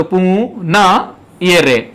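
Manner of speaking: पु and न held apart, slowly, then रे; syllable by syllable. Shown as a demonstration. Teacher showing where रे is pronounced, slowly syllable by syllable. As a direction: stretch "पु… न…" then point out रे. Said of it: "यानी रे का उच्चारण या हुआ".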